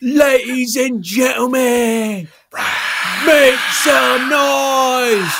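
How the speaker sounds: slow, high booming announcer call; big arena intro; announcer hype. Acted. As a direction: stretch it out like a ring announcer. Announcer hyping the crowd.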